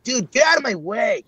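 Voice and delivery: put-on high, snotty kid voice telling you off; be the mean kid shoving past. Shown as a demonstration. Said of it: "a mean guy".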